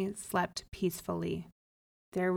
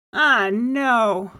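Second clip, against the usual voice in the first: loud protest climbing very high; dismayed cry; 12 semitones higher, 10 dB louder than usual. A narrator's reading. Man told to house a goat, loud dismayed cry. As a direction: cry out in high-pitched disbelief. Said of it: "cried the man".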